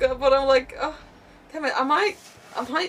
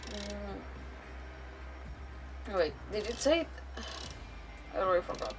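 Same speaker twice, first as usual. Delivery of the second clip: usual speaking voice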